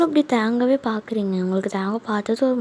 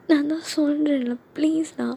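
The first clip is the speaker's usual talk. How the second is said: higher-pitched weepy plea; tearful; 4 semitones higher than usual. Acted. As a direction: beg him to drop it, near tears. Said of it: "ஏன்டி அழுகுற?".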